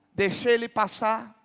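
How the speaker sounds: ordinary delivery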